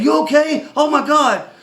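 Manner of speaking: high, loud, frantic re-enacted outburst; excited. Driver checking on the stranger he hit, excited outburst. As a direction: blurt it out, overwhelmed with relief. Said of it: "I get excited easy".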